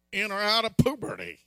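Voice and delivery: ordinary talk, nothing marked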